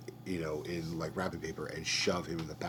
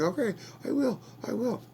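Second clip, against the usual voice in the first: frantic agreeing; 3 dB louder than usual. Retold or imitated. Captive agrees, desperate.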